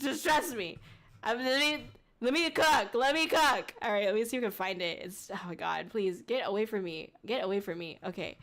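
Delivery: ordinary talk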